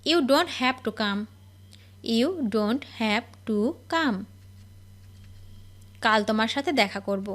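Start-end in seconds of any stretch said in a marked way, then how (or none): 2.2-4.2 slow, clear repeat. Shown as a demonstration. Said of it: "teacher model then slow repeat".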